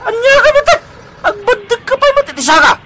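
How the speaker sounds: put-on high, indignant old man scolding; shrill angry scolding. Old man scolding the stranger who trod on him. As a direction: shriek at him for stepping on you.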